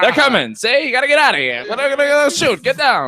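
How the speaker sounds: high, excited mock Mid-Atlantic movie accent; old-movie accent. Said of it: "We need everyone to have a fucking accent".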